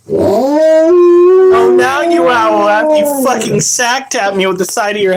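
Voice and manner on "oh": loud high oh; pained shout. Real, not acted. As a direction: cry out in pain. Pet hit him.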